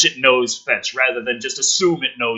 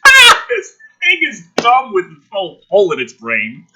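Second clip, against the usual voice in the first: laughing through the words, higher and louder; 8 semitones higher, 6 dB louder than usual. Real, not acted. Comedian laughing at the dumb creature, laughing speech. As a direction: chuckle it out.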